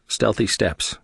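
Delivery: usual speaking voice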